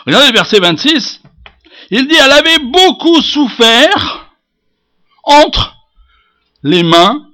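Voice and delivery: loud, high declamation of scripture; forceful preaching. Acted. Preacher proclaiming her suffering under doctors, forceful. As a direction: hammer the verse out phrase by phrase.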